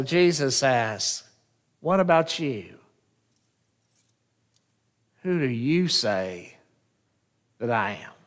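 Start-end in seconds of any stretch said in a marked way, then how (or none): 1.8-8.1 soft, intimate question. Pretend. Jesus asks the disciples.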